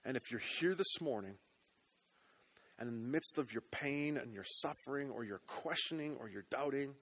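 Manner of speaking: ordinary delivery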